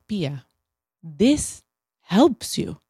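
slow, gentle coaxing; imitating Italian partner. Italian partner urging her to eat pasta, slow coaxing. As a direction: coax her kindly, slowly.

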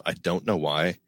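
usual speaking voice

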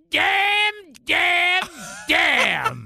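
high-pitched sitcom catchphrase cry; three spaced cries, starting very high and dropping; Florida from Good Times. Acted. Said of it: "in the words of, uh, of Florida".

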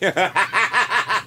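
high laugh that rolls out naturally; ordinary laugh demo. Shown as a demonstration. Showing how most people laugh, ordinary laughter. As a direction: chuckle along like most people. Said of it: "Most people are like".

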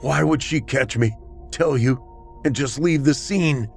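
high-pitched, panicked question. A narrator's reading. Homeowner's suspect argues his innocence, panicked. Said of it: "he asked in a panic".

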